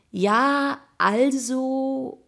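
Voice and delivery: long drawn-out words held high; outrageously slow. A narrator's reading. Jakob keeping his eager friend waiting, outrageously slow. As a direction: stall teasingly, stretching each word. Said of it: "begann Jakob unverschämt langsam".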